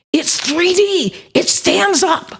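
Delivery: slow, high-pitched mock amazement, stretching each word; excited student. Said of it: "he was all excited".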